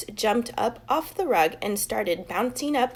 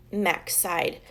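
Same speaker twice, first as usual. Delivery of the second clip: usual speaking voice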